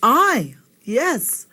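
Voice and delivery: ordinary delivery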